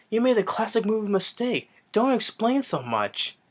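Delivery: high-pitched mocking quote; hammed-up scolding movie line. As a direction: say it high, drawing out the end. Acted movie line, mocking villain.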